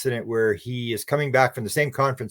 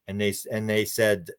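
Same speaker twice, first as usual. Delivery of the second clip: usual speaking voice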